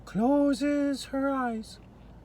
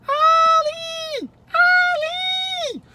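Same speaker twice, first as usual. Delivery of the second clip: high, loud shout across the trees; calling out; 13 semitones higher, 10 dB louder, much slower than usual. Acted. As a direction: yell for the owl to come. Jojo calls the owl.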